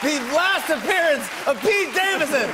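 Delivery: high, projected announcer call; show host sign-off. Real, not acted. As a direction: announce the guest over the applause. Host announces guest over applause.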